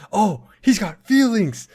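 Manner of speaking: high, loud, drawn-out mock outburst; joking mock yell. Co-host jokes about a bland character finally showing feelings, mock yelling. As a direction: stretch it out in pretend amazement, high and loud. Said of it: "we were joking".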